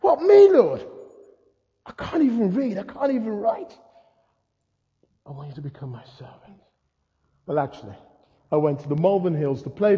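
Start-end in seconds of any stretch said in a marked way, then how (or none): 0.0-3.8 high-pitched, disbelieving squeal easing into pleading; incredulous protest. Acted. Stunned man answers the Lord's call, incredulous protest. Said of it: "And you go". 5.2-6.6 very soft, low and quick; hushed divine insistence. Acted. The Lord repeats his call to a doubter, hushed.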